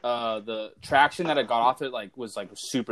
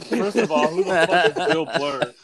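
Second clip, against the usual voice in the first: laughing disbelief; 3 semitones higher, 3 dB louder than usual. Retold or imitated. Mocking friend's wrong name.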